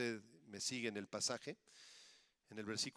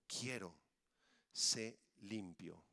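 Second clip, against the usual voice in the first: low, slow, soft assurance; calm, deliberate pronouncement, pitched low; Jesus' gentle command; 7 semitones lower, 3 dB quieter, much slower than usual. Acted. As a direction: speak it slowly with quiet authority. Jesus' healing words quoted.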